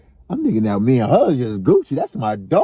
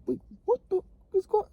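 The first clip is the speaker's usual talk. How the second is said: high, fast incredulous squeak; mock-shocked what-the; shocked disbelief; 6 dB quieter, faster than usual. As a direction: act stunned, voice shooting up. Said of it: "I'm like".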